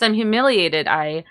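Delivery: ordinary delivery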